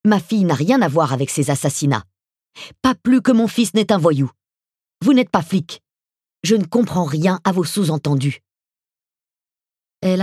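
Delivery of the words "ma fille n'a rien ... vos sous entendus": higher and louder, furious and fast; angry outburst. Acted. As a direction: lash out in defense of her kids. Leili angrily defends her children.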